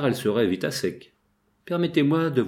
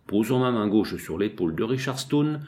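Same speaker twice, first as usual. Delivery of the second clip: plain reading voice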